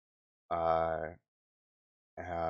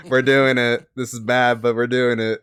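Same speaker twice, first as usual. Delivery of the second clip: laughing; 3 semitones higher, 9 dB louder than usual. Real, not acted. Laughing at own bad voice.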